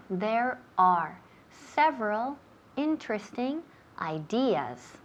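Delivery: word by word with pauses between chunks; teacher repeating. Shown as a demonstration. Teacher repeating the sentence for the student, chunked careful model.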